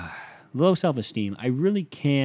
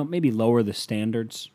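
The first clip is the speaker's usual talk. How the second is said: ordinary talk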